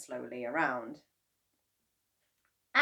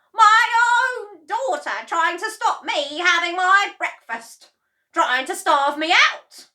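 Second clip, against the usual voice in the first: Grandma shouting; 13 semitones higher, 15 dB louder than usual. A narrator's reading. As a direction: accuse her own daughter. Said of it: "shouted Grandma".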